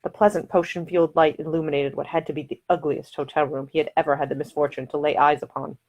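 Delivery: usual speaking voice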